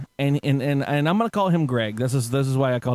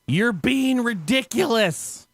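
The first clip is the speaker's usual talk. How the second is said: high, drawn-out imitated scolding yell; mock scream; 8 semitones higher, much slower than usual. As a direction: act out a man screaming at her, pitch up but not full volume. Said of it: "He proceeds to scream at her".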